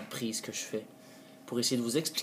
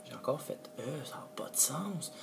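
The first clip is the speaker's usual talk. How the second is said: whispered aside; 3 semitones higher, 9 dB quieter than usual. Real, not acted. Creator flubbing his intro, whispering to himself. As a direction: breathe it out as a frustrated whisper. Muttering at his own blooper.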